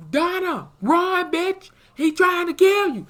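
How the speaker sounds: high, loud shout to run; yelling warning. Pretend. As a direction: yell at her to run for her life. Warning Donna in the story.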